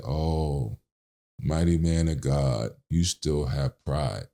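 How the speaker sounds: slow, low, soft and knowing; solemn rebuke. Retold or imitated. Eddie gently rebuking the narrator's pride, slow soft solemn. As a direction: gently scold a proud man.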